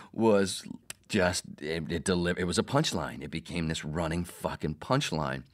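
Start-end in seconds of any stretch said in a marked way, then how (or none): none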